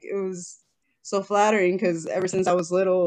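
normal talking voice

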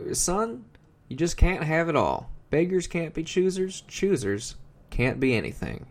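lightly voiced father handing down folksy wisdom; mock fatherly lecture.

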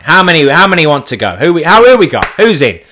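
loud, high, eager call to the room; rally the mates for dinner, all fired up; excited rallying. Retold or imitated. To his mates on the floor, loudly excited.

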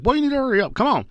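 put-on high, bossy kid taunt; kid's bossy voice. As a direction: urge grandpa on, cocky. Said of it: "He's like".

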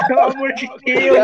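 exasperated. Real, not acted. Exasperated about music.

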